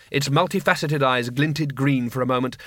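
plain reading voice

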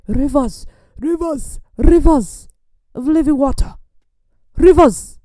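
put-on high, loud, accented preacher chant; Rodney Howard-Browne. Imitating revival minister's catchphrase, high, loud cries. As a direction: punch each word as a separate ringing cry. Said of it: "his thing is to say".

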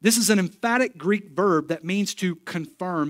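normal talking voice

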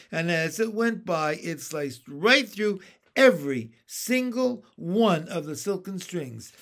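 ordinary delivery

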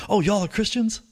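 high, eager retold question; excited woman, imitated. She asks about his group of friends, imitated high surprise. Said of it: "And she goes".

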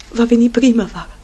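ordinary delivery

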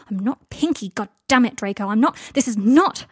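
loud, exasperated protest; angry outburst. A narrator's reading. Harry rejecting the name Pinky, angry outburst. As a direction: burst out, then break off.

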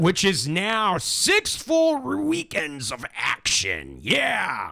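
excited, stretched-out announcing. Real, not acted. Host plugging the Renaissance festival, excited. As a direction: stumble over the words with excitement. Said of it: "I'm so excited I can't even talk".

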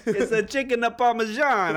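high, loud caricature Italian; mock Italian accent. Teasing his friend over chicken Parmesan, mock Italian accent. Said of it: "why'd you get Italian?".

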